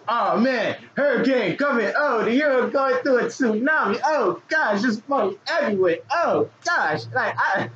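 high, panicky storm-warning weatherman; frantic weatherman. Pretend. Weatherman hyping a market tsunami, frantic and high. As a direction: sound the alarm like a hurricane is landing. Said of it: "the weatherman".

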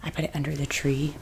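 ordinary talk, nothing marked

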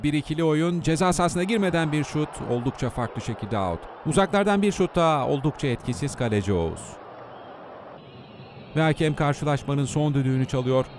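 normal talking voice

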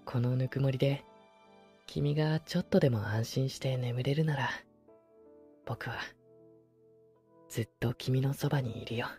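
soft tender voice close by; pillow talk. Acted. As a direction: murmur lovingly to soothe to sleep. Sleeping beside listener, tender.

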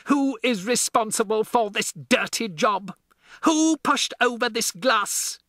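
high, loud bullying shout; roaring. A narrator's reading. Headmistress demanding a culprit, loud high roar. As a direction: bellow at the class to confess. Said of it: "she roared".

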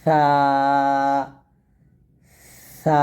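সা as a soft th with escaping air and a long thin vowel, twice; soft airy tha. Shown as a demonstration. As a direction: let air flow into each drawn-out সা. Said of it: "পাতলা হবে, বাতাস বের হবে". (নরম, পাতলা, বাতাসসহ সা)

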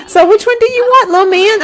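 put-on high-pitched little-kid talk; coaxing a child. Player asks the little boy to choose a pet, high coaxing voice. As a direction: ask it high and lilting, as to a small child.

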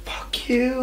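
breathy whispered high curse; teen cursing at a mom, whispered. Retold or imitated. Teen snapping at mom, whispered. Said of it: "I was like".

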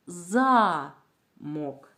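замок with a long, loud ЗА, then мок; first syllable of замок drawn out, second dropped; stress on first syllable. Shown as a demonstration. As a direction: stretch and punch the ЗА in замок. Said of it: "перший склад вимовляється голосніше".

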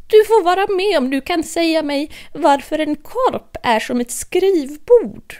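high, playful Hatter posing a riddle, slowing at the end; a daft host testing his guest; Mad Hatter. Acted. Hatter challenging Alice with a riddle, high and playful.

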